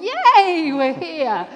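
normal talking voice

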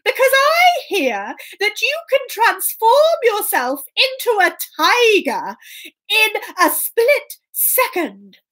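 high, slow, bold cat voice; plucky storybook cat facing an ogre; brave Puss. Acted. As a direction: put on a high voice and declare it grandly. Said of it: "but knew he had to be strong".

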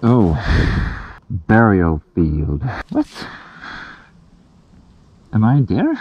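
normal talking voice

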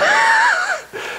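sudden shrieking laugh burst; a high whooping laugh. Retold or imitated. Laughing at the offer.

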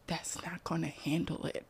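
unvoiced deadpan whisper. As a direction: whisper it like a serious complaint. Child whispering refusal.

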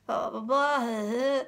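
normal talking voice

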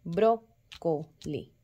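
"brócoli" slowly split, BRÓ-co-li; stress on BRÓ. Shown as a demonstration. Teacher showing where the force is, "brócoli" with BRÓ stressed. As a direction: punch the first beat of "brócoli", separate the rest. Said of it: "donde estaba la fuerza de la sílaba".

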